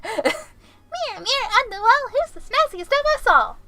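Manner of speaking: high, lilting fairy-tale voice; high-pitched storybook question to a mirror. Retold or imitated. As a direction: ask the magic mirror in a sing-song. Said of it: "and I'm like".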